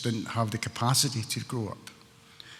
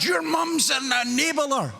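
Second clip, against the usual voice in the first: angry retold shout; 12 semitones higher, slower than usual. A woman at an AA meeting scolding him, shouting angrily. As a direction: yell it at him like an angry stranger. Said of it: "She was actually just mad".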